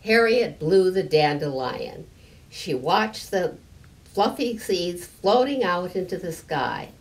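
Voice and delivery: usual speaking voice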